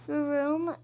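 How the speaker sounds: ordinary talk, nothing marked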